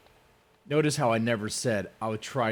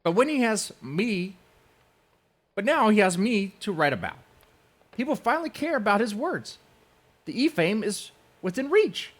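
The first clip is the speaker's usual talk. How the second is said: high, loud sneering mimicry; mocking imitation; 11 semitones higher, 5 dB louder than usual. Mocking the rival's gloating in a high loud voice.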